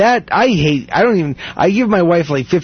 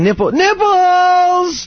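high, drawn-out imitation of a holler; mock yelling; 12 semitones higher, much slower than usual. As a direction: stretch the word out in a high mock call. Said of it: "she just starts yelling".

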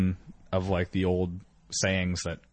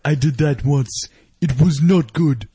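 put-on slow, higher, louder African accent; mock African accent; 6 semitones higher, 7 dB louder than usual. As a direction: confess as a hammed-up Nigerian pastor.

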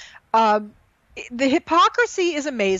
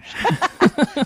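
amused laugh at oneself; 4 semitones higher, 3 dB louder than usual. Real, not acted. Host caught mispronouncing a name, laughing. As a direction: burst out laughing at your own slip.

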